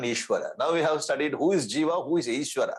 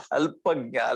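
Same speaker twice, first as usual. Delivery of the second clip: laughing; slower than usual. Real, not acted. Teacher comparing his house with the temple, laughing. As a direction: laugh spilling into the word.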